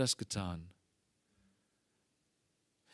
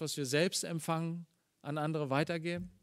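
ordinary talk

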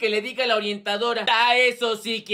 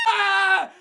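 high, sustained shriek; sudden long squeal cutting into the lesson; piercing scream; 4 semitones higher, 4 dB louder than usual. Acted. Regina interrupts the maths class, screaming. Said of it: "No quiero volverte a escuchar gritando".

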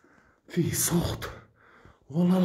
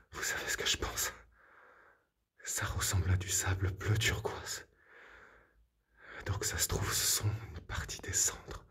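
whisper; 7 semitones lower, 13 dB quieter than usual. Real, not acted. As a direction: lean in and whisper the theory. Hushed in a dark house.

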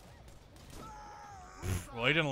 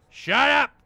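loud, higher yell; angry shout; 10 semitones higher, 10 dB louder than usual. Real, not acted. Gamer shouting at talkative villain Commandant Steele, angry yell. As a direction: yell it to silence him.

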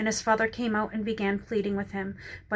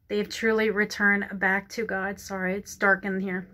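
usual speaking voice

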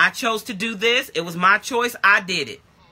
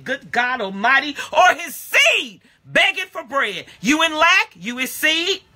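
full-force preaching; 5 semitones higher than usual. Acted. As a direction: preach it hard to the church.

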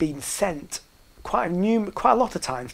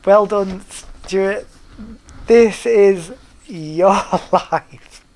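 mock TV host; 5 semitones higher, 10 dB louder, much slower than usual. Mock ceremony announcement.

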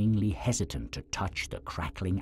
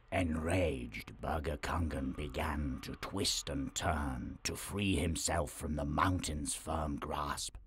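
ordinary delivery

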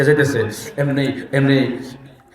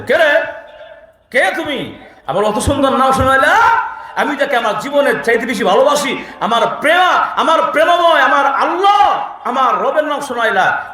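high, eager, breathless with delight; overjoyed Ibrahim; 9 semitones higher than usual. Acted. Ibrahim to the stranger, thrilled at hearing God's name. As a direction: cry out as if enchanted by the sound.

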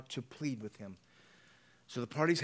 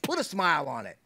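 raised-voice fatherly order; acted parental command, pushed louder; stern command; 3 semitones higher, 7 dB louder than usual. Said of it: "had the right to command him".

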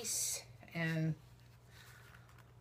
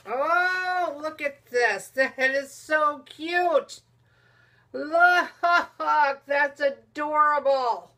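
loud, high, drawn-out gushing; delighted squeal; 7 semitones higher, 12 dB louder, much slower than usual. Real, not acted. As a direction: squeal with real delight over a cute gift. Delighted at the gift inside.

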